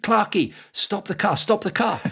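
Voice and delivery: excited high plea to a friend; urgent call. Retold or imitated. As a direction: call out high to stop the car.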